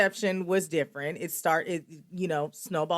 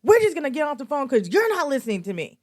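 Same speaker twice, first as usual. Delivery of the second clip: retold screaming; 9 semitones higher, faster than usual. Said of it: "And I'm screaming".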